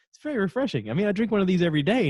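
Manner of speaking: high-pitched, quick self-justifying; mock innocent defensiveness. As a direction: imitate a friend cheerfully defending his habit. Bert defending his Kool-Aid habit.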